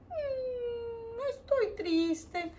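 soft whimpering "estoy triste", slowed; mock-sob the words for the kids; showing sad. Shown as a demonstration. Teacher shows the children how sad sounds, soft and whimpering. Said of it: "muéstrenme cómo es triste".

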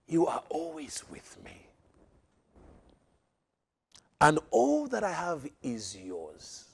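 soft, tender father's reassurance; father soothing his jealous elder son softly. Acted. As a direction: gently reassure the son, slow and hushed.

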